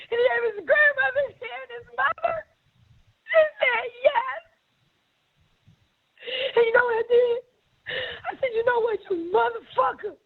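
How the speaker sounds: high, tearful voice, pushing through the story; really crying. Real, not acted. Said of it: "Don't cry".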